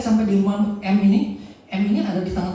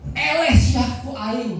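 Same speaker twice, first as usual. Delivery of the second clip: mocking spirit voice; 5 semitones higher, 4 dB louder, much slower than usual. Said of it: "dia bilang begini".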